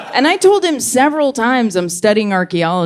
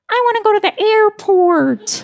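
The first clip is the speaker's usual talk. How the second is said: put-on much higher, delighted kid voice; eager toddler; 12 semitones higher than usual. Toddler telling babysitter his wish, high and thrilled. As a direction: beam like a boy naming his dream outing. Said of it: "he's like".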